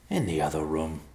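gravelly, grudging answer; rough-voiced stranger. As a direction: grumble it in a gravelly voice. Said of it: "the gravelly voice said".

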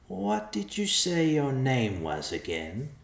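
high, slow, wheedling rogue; greedy little bandit, drawn out and high; sly bandit Morduk. A narrator's reading. Morduk asks the messenger his name, sly and high-pitched.

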